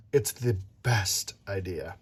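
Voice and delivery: quiet confidence. Acted. Amelia's confident answer.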